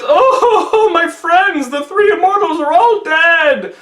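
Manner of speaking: high, hammed-up wail; lamenting emperor. A narrator's reading. Emperor mourning his three friends, high theatrical lament. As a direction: stretch it out in a mock-woeful high voice.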